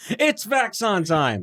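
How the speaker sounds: high, slow hype call; catchphrase. Acted. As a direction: announce the show with gusto. Show catchphrase called out.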